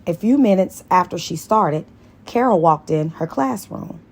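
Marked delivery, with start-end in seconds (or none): none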